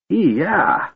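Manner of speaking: high gagging yelp; a shocked, revolted shriek; disgusted yelp. Acted. Tass sniffs smelling salts.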